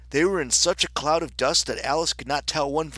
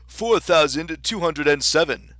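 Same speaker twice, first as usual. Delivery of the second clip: loud, higher storybook king; 4 semitones higher, 7 dB louder than usual. Acted. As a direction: announce it as a precise king.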